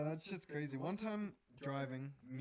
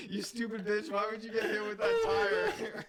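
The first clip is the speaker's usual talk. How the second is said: loud, higher mock shout through laughter; retold screaming; 6 semitones higher, 8 dB louder than usual. Retelling the driver yelling at the crash victim, laughing mock shout. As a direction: yell it like a furious driver, laughing while telling. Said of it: "just straight up screaming his head off".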